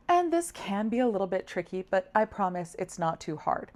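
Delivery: normal talking voice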